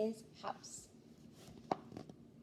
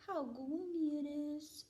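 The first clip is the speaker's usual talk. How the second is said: lightly voiced starfish; 4 semitones higher, slower than usual. A narrator's reading. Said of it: "whispered the starfish".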